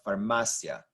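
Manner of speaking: "farmacia" as far-MAH-sya, ending in one syllable; blended vowels. Shown as a demonstration. Teacher gives example of blended sounds, "farmacia" stressed on MA. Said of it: "blend together to make sia".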